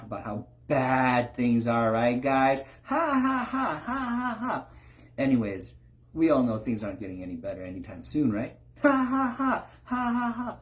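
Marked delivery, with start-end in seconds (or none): 2.8-4.7 high hammed-up fake laughter; mock laugh track. Preacher mocks comedians with a fake canned laugh. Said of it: "The comedians, what are they communicating?". 8.8-10.6 high hammed-up fake laughter; mock laugh track. Preacher mocks comedians with a fake canned laugh. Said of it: "The comedians, what are they communicating?".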